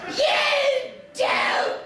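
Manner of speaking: high, drawn-out hysterical wail; hammed-up screaming meltdown. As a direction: shriek the accusation word by word. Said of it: "I began to scream at her".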